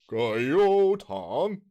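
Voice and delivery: very slow, high, drawn-out delivery; emotional take. Shown as a demonstration. Host records takes in different emotions, high and drawn out. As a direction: stretch each syllable right out, pitched high. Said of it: "do it in different emotions".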